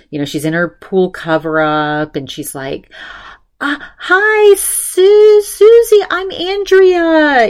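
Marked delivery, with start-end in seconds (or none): 3.6-7.5 much higher, louder, slow awkward greeting; awkward hello. Retold or imitated. Andrea meeting Susie for the first time, high awkward greeting.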